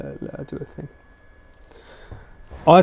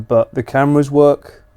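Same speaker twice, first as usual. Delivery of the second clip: ordinary talk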